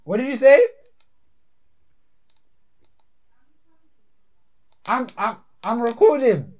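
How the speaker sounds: loud, high call across the house; shouting to mom. Real, not acted. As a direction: holler back that you're busy.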